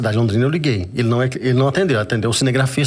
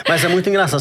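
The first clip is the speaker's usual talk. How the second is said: laughing; 5 semitones higher, faster than usual. Real, not acted. Laughing at interviewer's voice question.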